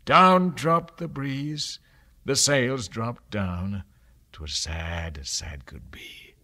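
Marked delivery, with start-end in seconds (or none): 4.3-6.3 low, sorrowful whisper; sad whisper. A narrator's reading. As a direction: let the sadness sink into a whisper. Becalmed, sad silence.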